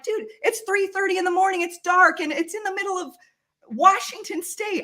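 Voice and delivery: high-pitched, mildly freaked-out complaint; freaked-out protest. Retold or imitated. Woman balking at a dark field before dawn, mock freaked-out protest. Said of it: "I'm kind of freaked out by this".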